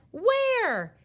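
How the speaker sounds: high, loud bewildered question; exasperated father's call; searching shout. A narrator's reading. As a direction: holler it, hunting for the voice.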